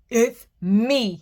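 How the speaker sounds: loud grunting big goat; big goat grunting back at the troll. Acted. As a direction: grunt it heavily. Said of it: "he grunted".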